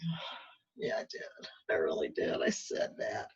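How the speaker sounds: hushed, breathy admission; sheepish whisper. Retold or imitated. Admitting to her inner voice she did surrender, whispered. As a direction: whisper it like you've been caught out.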